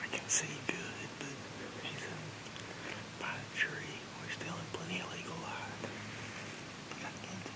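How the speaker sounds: hushed hunter's whisper. Real, not acted. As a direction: whisper so the deer won't hear. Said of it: "hunter whispering in the stand".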